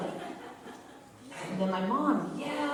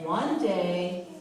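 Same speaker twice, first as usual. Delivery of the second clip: ordinary delivery, nothing marked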